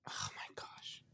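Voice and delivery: whispered under breath. Real, not acted. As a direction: whisper it to yourself. Stuck on the answer.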